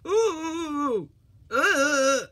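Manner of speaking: pained high groan. A narrator's reading. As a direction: groan as if hurt. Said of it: "groaned Percy".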